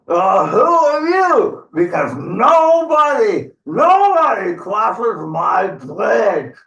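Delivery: high, loud, drawn-out troll shout; indignant troll bellow. Acted. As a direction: bellow slowly, stretching the word nobody.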